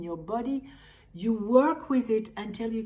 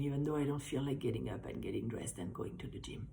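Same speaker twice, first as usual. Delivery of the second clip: fast, soft whispered grumble; quick hushed aside; reluctant whisper; 6 semitones lower, 9 dB quieter, faster than usual. Pretend. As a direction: whisper the excuses under your breath. Voicing reluctant inner thoughts.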